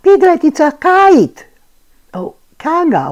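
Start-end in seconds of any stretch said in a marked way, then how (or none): 0.0-1.5 put-on high excited kid voice; little child voice. A narrator's reading. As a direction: call out like a small child. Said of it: "said Roo".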